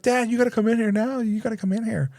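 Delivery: put-on high, fast, frantic child pleading; a scared ten-year-old begging his dad to come now; panicked kid voice. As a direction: son calling urgently for dad. Panicked son calling dad.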